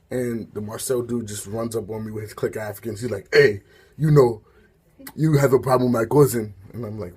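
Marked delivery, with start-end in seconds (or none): 3.3-6.5 loud challenge in a mock Haitian accent; Haitian-sounding accent. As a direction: confront him in an imitated Haitian accent. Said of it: "why do you have a Haitian accent".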